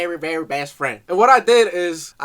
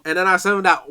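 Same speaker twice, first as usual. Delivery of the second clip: usual speaking voice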